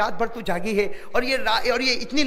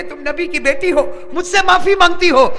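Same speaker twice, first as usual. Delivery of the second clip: anguished outcry; 8 semitones higher, 10 dB louder than usual. Acted. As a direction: cry out in anguish. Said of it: "حضرت علی تڑپنے لگے".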